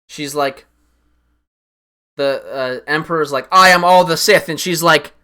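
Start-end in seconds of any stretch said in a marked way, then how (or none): 3.5-4.5 louder, higher, booming like an evil emperor; loud mock Sith boast; villain declaration. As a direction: proclaim it to the heavens. Emperor declares in retold scene.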